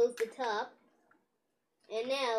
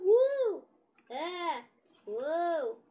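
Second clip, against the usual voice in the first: swinging cries; 4 semitones higher, much slower than usual. Pretend. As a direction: whoop while swung about. Figure swung around in play.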